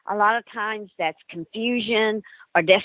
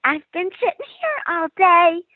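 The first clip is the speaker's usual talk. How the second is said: put-on eerie little girl voice; little girl ghost; 10 semitones higher than usual. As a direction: voice the ghost child sitting there. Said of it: "She says".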